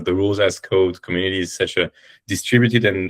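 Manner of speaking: ordinary talk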